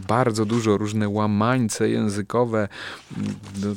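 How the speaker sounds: ordinary talk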